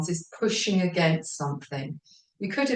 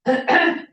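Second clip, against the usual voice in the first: sharp high throat clear; 12 semitones higher, 7 dB louder than usual. Real, not acted. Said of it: "excuse me".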